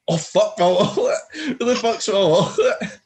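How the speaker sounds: high-pitched mock alarm; imitated panic. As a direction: mimic a friend suddenly missing their wallet. Imitating friend discovering lost wallet.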